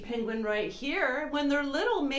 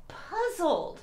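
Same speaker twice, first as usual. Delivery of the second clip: puzzled; 7 semitones higher than usual. Shown as a demonstration. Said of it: "let's pretend we're all puzzled".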